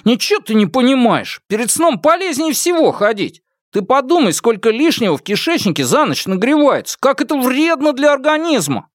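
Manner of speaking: very high-pitched, insistent comic protest; high, emphatic lecturing of a roommate; argumentative student. A narrator's reading. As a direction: argue back in a high put-on voice. Zhou Jun argues back.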